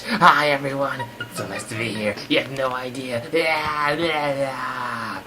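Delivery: low, loud character voice; boisterous greeting. Acted. Guest happy to be here, boisterous low-voiced hello. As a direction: blurt the hello, end in drawn-out cheers. Another guest bursts in excitedly.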